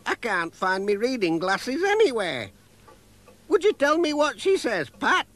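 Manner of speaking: put-on high, friendly old woman; sweet elderly village lady. A narrator's reading. Elderly postmistress voiced in story.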